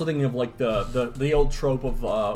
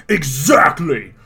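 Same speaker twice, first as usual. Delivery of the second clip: emphatic shout; 6 dB louder, much slower than usual. Acted. Dredd's exclamation.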